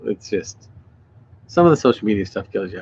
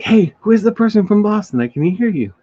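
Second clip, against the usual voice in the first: higher, louder retold call across a party; eager calling out; 12 semitones higher, 5 dB louder than usual. [name] calling over a woman with a Boston accent, retold as eager calling out. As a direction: imitate an excited shout to someone.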